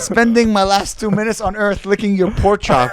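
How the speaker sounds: loud, high mock refusal; hammed-up offended reply; mock indignant.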